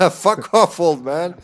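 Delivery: loud, laughing brush-off; laughing jeer. Real, not acted. Laughing as they chase off game character.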